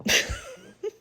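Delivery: very high burst of laughter; shrieking laugh. Real, not acted. Laughing at her own fuck them, high shriek. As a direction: cackle up high.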